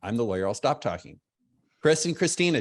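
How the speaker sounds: normal talking voice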